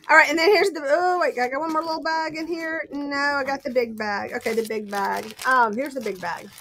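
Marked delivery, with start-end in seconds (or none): none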